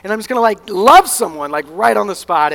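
high, loud burst of hype; mock fired-up sermon zeal. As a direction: shout it like you're pumped up at a rally. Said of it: "all fired up during a sermon type thing".

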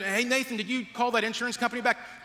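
a hint of someone interrupting from the living room; family member calling out.

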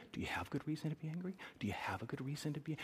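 breathy whisper, quick and close; whispered question. Acted. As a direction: whisper it like a conscience. God's question repeated, hushed.

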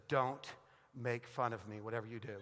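low, soft deadpan warning; wife's warning. Retold or imitated. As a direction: imitate your wife quietly warning you, with a pause. Wife's parting warning, retold as punchline.

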